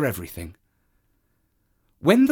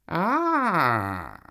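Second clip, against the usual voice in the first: high knowing cat sigh; a sly cat watching; 6 semitones higher than usual. Acted. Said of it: "said the cat".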